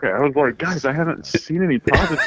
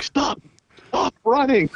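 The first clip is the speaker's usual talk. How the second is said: retold scared cry; 10 semitones higher, slower than usual. Telling friends how scared everyone was, mock-panicked cry. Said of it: "And I was like".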